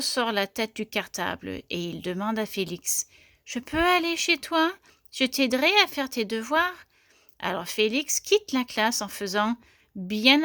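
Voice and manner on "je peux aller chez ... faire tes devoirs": put-on higher, eager child-like asking; pleading little mouse. A narrator's reading. Mouse asks boy to visit his home, high and eager. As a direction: ask hopefully in a raised little voice.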